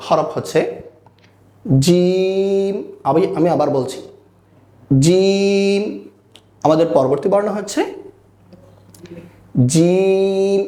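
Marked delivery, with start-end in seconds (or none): 1.8-2.7 জিম with a long held vowel; drawn-out letter. Shown as a demonstration. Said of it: "আমরা একটু টেনে বলবো". 5.0-5.8 জিম with a long held vowel; drawn-out letter. Shown as a demonstration. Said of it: "আমরা একটু টেনে বলবো". 9.7-10.6 জিম with a long held vowel; drawn-out letter. Shown as a demonstration. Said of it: "আমরা একটু টেনে বলবো".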